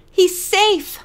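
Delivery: high, loud cry of relief; cried out. A narrator's reading. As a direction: call out the good news, relieved. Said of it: "she cried out to Hannah".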